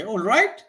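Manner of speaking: "All right" lifted high into a question; high rising "All right" on the final word; simply asking. Shown as a demonstration. Teacher showing how to simply ask, rising question. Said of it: "simply ask, nothing else".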